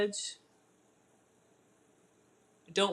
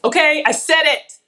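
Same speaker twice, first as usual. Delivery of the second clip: loud, higher-pitched outburst; yelled admission; 6 semitones higher, 11 dB louder than usual. Real, not acted. Cook confessing to viewers she wanted the dessert, yelling. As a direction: blurt it out in a real yell. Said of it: "I didn't mean to yell".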